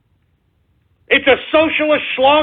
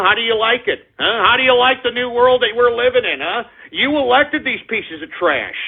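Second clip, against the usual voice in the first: normal talking voice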